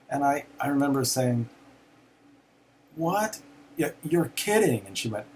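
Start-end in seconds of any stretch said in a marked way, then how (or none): 3.0-4.9 higher, shocked "What?"; stunned disbelief. Retold or imitated. Son hears of father's death, shocked disbelief. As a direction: retell the shock of hearing the news.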